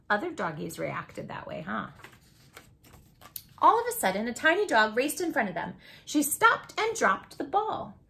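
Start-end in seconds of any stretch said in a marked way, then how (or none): none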